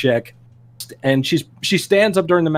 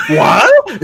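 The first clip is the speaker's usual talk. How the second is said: shocked what; 11 semitones higher than usual. Retold or imitated. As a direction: replay the disbelief. Recalling his shock at the news.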